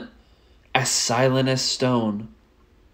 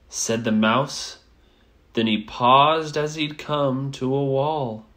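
ordinary delivery

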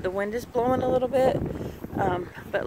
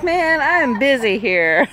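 voicing the hen; 4 semitones higher, 6 dB louder, slower than usual. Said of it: "She's like".

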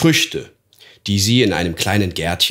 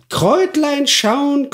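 Kräutlein schauen squeaked very high and slow; sly crone peering at herbs, shrill; high croaking hag; slower than usual. Acted. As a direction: stretch Kräutlein schauen up into a thin witch squeal. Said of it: "krächzender Stimme".

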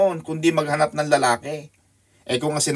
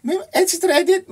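amused high start; 4 dB louder than usual. Real, not acted. Pastor amused, calling out to Sister Edith, high. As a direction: pitch jumps way up in amusement.

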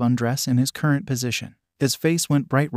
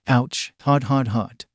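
yelping hot from a burnt hand; sharp ouch, hands stinging; pained cry; slower than usual. A narrator's reading. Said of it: "Ko cried".

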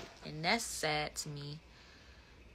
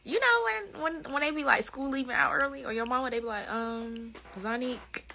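higher, lively recollection; excited memory; 7 semitones higher, 5 dB louder than usual. Retold or imitated. Recalling being called out of school, higher and excited. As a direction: relive the rush out the door.

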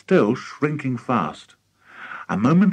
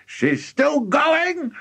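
high, slow, delighted shout; drawn-out crowing delight; gleeful shout; much slower than usual. A narrator's reading. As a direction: stretch it out high with glee. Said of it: "shouted Mister Cranky gleefully".

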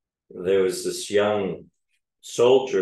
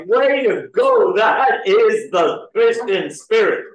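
sarcastic cheer; 11 semitones higher, 5 dB louder, slower than usual. Acted. Sarcastic praise of warring Christians.

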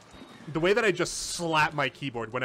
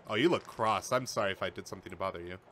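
softer, fast sheepish apology; 9 semitones lower, 5 dB quieter than usual. Real, not acted. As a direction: apologize gently to a grumpy character. Talking to game character.

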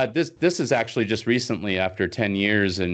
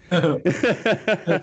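real laugh; 11 semitones higher than usual. Real, not acted. Laughing at the alien head.

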